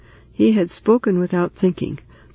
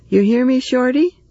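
raised, high call to the next room; 8 semitones higher, 4 dB louder than usual. Acted. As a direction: call out to him in the other room. Calling to man in next room.

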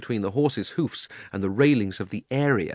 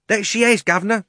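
high, loud, amused onlooker; a man in the crowd chipping in; cheeky Cockney bystander; 12 semitones higher, 6 dB louder than usual. Acted.

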